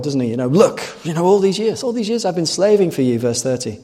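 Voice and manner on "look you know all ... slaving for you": higher, aggrieved complaining son; indignant older brother. Acted. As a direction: protest your years of hard work. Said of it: "He summarizes his very impressive record".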